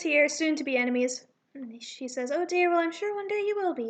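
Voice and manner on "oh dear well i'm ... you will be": higher, bright gush of delight; super enthusiastic. Acted. Esther greeting little Zach, super enthusiastic and higher. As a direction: coo over the child eagerly. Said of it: "super enthusiastic here".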